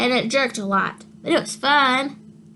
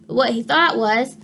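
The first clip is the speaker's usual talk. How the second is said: plain reading voice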